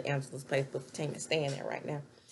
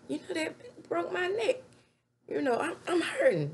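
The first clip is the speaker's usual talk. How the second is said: much higher, whiny put-on voice of the injured woman; mock hurt whine; 12 semitones higher than usual. Deja whining to Zach about her neck, high mock hurt voice. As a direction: play up the pain like she's faking it.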